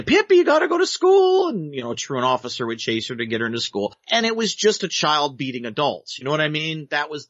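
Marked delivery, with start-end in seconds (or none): 0.0-1.5 much higher and louder scolding teacher; a shrill-pitched mock scold, loud and high. Retelling Pippi's teacher, much higher, louder mock scold. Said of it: "The teacher would say".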